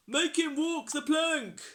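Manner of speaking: loud, high rowdy shout; pirate yell. A narrator's reading. Pirates condemn the troll, loud yell.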